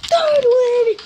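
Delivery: high, loud victory shout; excited shout. Real, not acted. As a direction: yell it out in triumph. Winning the round.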